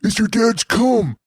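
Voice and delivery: high, squeaky mocking villain taunt. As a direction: sneer it in a shrill put-on voice, laughing. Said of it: "He's like".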